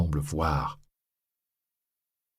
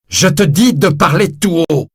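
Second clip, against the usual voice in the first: loud, higher angry order; furious shout; 10 semitones higher, 8 dB louder than usual. Acted. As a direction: shout him down. Javert shouting down Valjean.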